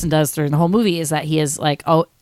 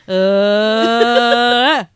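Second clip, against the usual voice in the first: [name] grunt; 5 semitones higher, 6 dB louder than usual. Retold or imitated. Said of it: "he's like".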